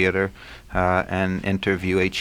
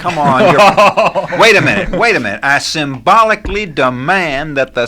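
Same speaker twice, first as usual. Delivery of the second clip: mock protest; 8 semitones higher, 10 dB louder than usual. Real, not acted. Host jokingly protesting the on-air delay to his guest, loud high mock outrage. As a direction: burst in with hammed-up indignation, much higher and louder.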